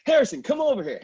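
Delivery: high, loud call across the room; calling excitedly. Retold or imitated. Staff call the producer over, loud and excited. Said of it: "and say".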